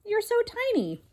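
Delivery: high, gentle princess voice; sweet princess. Acted. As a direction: coo it like a charmed princess. Princess speaks to mouse.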